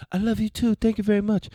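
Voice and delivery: playful, high, loud thank-you to a viewer; mock sweet reply. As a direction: squeal it back like a flattered fan.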